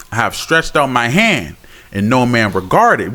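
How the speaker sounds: measured, solemn Bible reading; scripture reading. Acted. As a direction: read the verse like a preacher. Reads Proverbs aloud.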